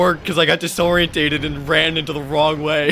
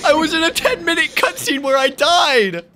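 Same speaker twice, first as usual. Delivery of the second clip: high, loud game rant; exasperated shouting; 10 semitones higher, 4 dB louder than usual. Real, not acted. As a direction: cry out in sheer exasperation. Ranting about a game death.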